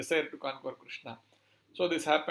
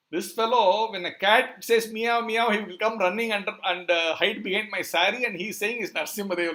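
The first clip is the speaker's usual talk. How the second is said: higher, louder mocking complaint; acted disbelief, belittling the boy; scoffing ridicule; 5 semitones higher, 5 dB louder than usual. Yashoda ridicules Balram's claim about Krishna, scoffing.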